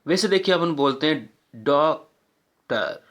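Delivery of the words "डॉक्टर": डॉक्टर split slowly into two separate beats; syllable by syllable. Shown as a demonstration. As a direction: break डॉक्टर after the first syllable, pausing before टर. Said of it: "टू बार effort करना पड़ रहा है". (डॉक्टर को दो हिस्सों में तोड़कर, धीरे)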